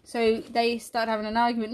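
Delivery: normal talking voice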